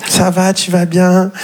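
slow, high singsong greeting; mock the hollow car call; fake-friendly caller. (appel faux-cul, lent et chantant)

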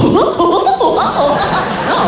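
high, drawn-out goofy cartoon hooting; wordless goofy laugh impression; Dingo's voice. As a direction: hoot and guffaw like a daft cartoon dog. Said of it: "c'est la voix de dingo".

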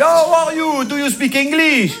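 English tourist woman. Retold or imitated. English tourist woman asking the waiter if he speaks English, high and slow. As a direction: ask it high and slow like a foreign visitor. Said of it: "la femme, elle a dit".

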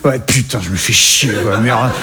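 low, grumbling mock Parisian accent. Québécois comic mocking the grumpy Parisian, low Parisian accent. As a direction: mutter the swearing like a grumpy Parisian. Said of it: "le parisien de base".

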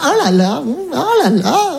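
lightly voiced anxious parent; mock fussy bobo parent. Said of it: "ils sont là".

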